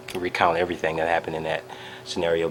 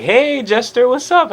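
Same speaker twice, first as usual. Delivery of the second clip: much higher, louder, squeaky hello; band greeting; 10 dB louder than usual. Retold or imitated. Band members greet the fan on the bus, high and loud. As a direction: chirp a friendly hey up high. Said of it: "They're like".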